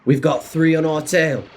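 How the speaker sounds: lightly put-on clone trooper voice; clone trooper accent. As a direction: try out a clone soldier's accent. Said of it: "I don't know if that was a good accent".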